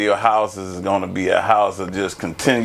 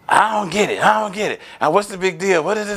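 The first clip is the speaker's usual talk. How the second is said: higher-pitched, mock-baffled complaining; mimicked exasperated partner; 9 semitones higher, faster than usual. Retelling the start of a conflict with a partner, mock exasperation. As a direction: imitate a partner who refuses to get it.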